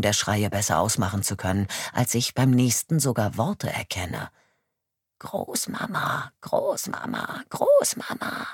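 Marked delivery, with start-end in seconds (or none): 5.2-8.5 put-on faint, whispered child's cries from far off; a little girl calling for grandma in panic, far away; distant panicked cries. A child calling from afar for her grandmother, faint and panicked. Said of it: "Panik und Horror hallen in der jungen Stimme wider".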